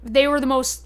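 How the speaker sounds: normal talking voice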